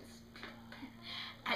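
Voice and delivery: hushed, voiceless mock whisper; imitated whisper. Brother in line for Peter Pan, imitated whisper. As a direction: whisper it under your breath.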